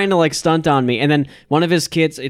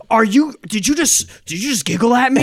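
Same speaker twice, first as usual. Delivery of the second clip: higher, mock-indignant parent; mad-mode parent; 7 semitones higher than usual. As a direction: parody an offended dad, pitch way up. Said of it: "Parents, when they get in mad mode".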